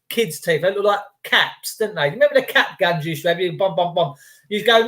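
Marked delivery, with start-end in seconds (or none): none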